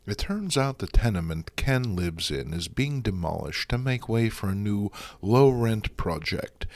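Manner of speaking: normal talking voice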